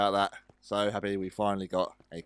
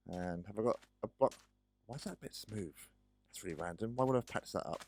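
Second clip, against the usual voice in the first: ordinary talk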